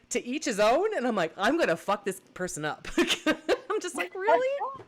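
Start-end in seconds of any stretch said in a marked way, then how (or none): 0.1-0.9 higher, louder mocking question; mocking echo. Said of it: "And he's like". 1.4-4.1 laughing through the words; can't hold back the laughter. Real, not acted. Laughing while retelling threat. 4.1-4.8 much higher, louder exasperation; exasperated really. Retold or imitated. Exasperated retold reply.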